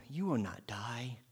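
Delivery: slow, hushed, coaxing whisper; serpent's whisper. Acted. The serpent whispers to the woman that she will not die. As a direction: whisper the lie slowly.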